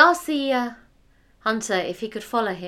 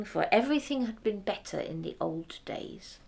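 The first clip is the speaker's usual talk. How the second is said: usual speaking voice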